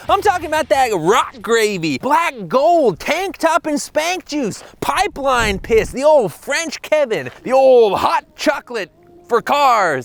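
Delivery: high, loud, drawn-out mock celebration; hammed-up hype. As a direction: whoop it up like an over-the-top hype man. Hyped-up comic reveal of oil.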